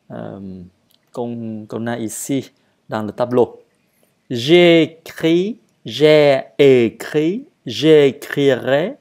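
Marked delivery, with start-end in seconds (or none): 4.3-9.0 loud, slow teacher's drill; deliberate, emphatic recitation of verb forms; model conjugation. Shown as a demonstration. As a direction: project and stretch each form for learners. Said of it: "à lire et à le dire".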